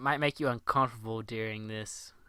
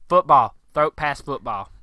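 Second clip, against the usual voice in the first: Tommy's clumsy command; 2 semitones higher, 12 dB louder than usual. Retold or imitated. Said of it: "he's like".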